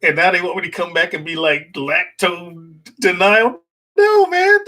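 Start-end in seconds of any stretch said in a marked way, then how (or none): 1.7-4.6 high, loud, slow "No, man" in mock protest; mock outrage. Real, not acted. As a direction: throw up a comically indignant refusal. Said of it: "mock outrage at diet".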